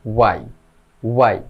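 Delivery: ওয়াই said "wai", no h; correct pronunciation. Shown as a demonstration. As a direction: begin ওয়াই straight on the w. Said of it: "এটা সঠিক উচ্চারণ হবে".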